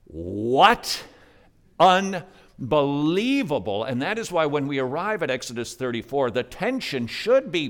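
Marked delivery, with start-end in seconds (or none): 0.0-3.8 incredulous. Acted. Preacher reacts to Israel's idolatry.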